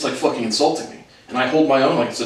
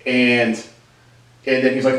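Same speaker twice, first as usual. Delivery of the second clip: usual speaking voice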